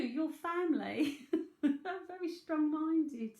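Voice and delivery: slow, laughing jab at a stubborn family; fond laughing complaint to the sitter. Real, not acted. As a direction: laugh through it, dragging the words. Laughs about scolding family.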